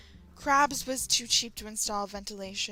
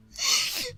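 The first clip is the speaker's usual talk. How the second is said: burst of laughter; 7 dB louder than usual. Real, not acted. Laughing at text.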